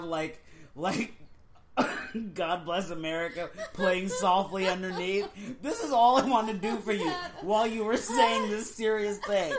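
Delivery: talking through tearful laughter; giggling uncontrollably while explaining; laughing hard. Real, not acted. Laughing fit.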